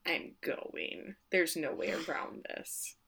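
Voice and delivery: slow, whispered insistence; quiet firm refusal. Retold or imitated. Shirley refusing her husband, in a slow whisper. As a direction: breathe it out, slow and immovable.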